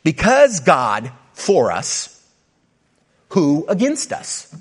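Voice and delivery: slow: "Because God for us" pulled out word by word; careful word-by-word read; slow literal reading. Shown as a demonstration. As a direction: read it out slowly like a literal translation. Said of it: "It literally reads this".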